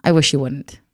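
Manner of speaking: ordinary talk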